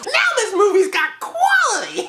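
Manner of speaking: high, put-on sarcastic voice; mock snooty praise. Reviewer mocking the movie, in a high sarcastic voice.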